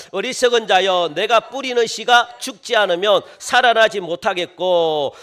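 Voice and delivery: loud, forceful verse reading; declaimed scripture reading. Real, not acted. Preacher leading everyone in verse thirty-six, declaimed scripture reading. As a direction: proclaim the verse for all to follow. Reads scripture aloud with congregation.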